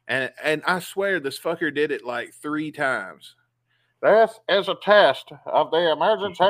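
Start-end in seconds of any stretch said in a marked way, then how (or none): 4.0-6.4 raised, loud recorded announcer read; emergency broadcast voice. Retold or imitated. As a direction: imitate the alert recording over the speaker. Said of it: "You'll hear it talking".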